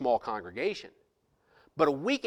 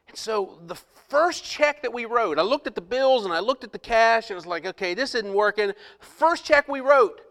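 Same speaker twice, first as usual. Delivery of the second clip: preaching build; 9 semitones higher, 7 dB louder than usual. Acted. Pastor preaching to the congregation about the first check, loud and high. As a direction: lift the story into a preaching climax.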